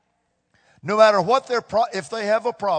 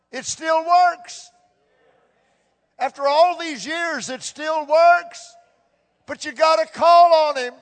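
fervent preaching; 9 semitones higher, 5 dB louder than usual. Acted. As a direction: preach it with full force.

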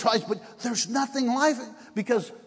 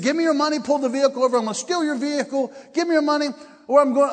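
high-pitched retold hold-up demand; mock robber's demand; 7 semitones higher than usual. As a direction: imitate an urgent robber demanding cash. Acts out robber's demand at knifepoint.

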